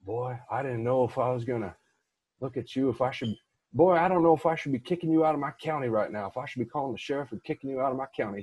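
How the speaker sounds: hammed-up rural Texas accent; exaggerated accent. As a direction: play a folksy small-county official in an overdone Texas accent. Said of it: "but he didn't say it with that accent".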